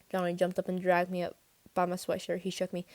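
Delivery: usual speaking voice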